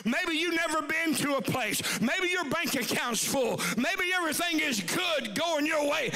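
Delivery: high, shouted sermon climax; preaching at full force. Acted. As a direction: revival preacher shouting to the congregation.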